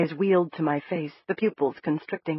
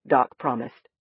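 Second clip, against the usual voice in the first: usual speaking voice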